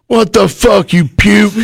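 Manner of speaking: high, sharp outraged yell; angry bark. Retold or imitated. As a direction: yell at the rookie in your seat. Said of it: "He goes".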